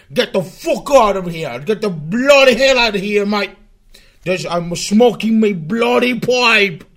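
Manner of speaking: loud, hammed-up mock British accent; over-the-top mock British accent, pipe in mouth. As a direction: bellow it like a stage British bloke with "mate" on every line. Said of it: "I'm using very British terms".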